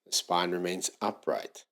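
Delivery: unhurried yoga teacher settling a class into breathing; guided meditation. Real, not acted. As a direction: ease them into stillness, phrase by phrase. Eases into leading breathing meditation.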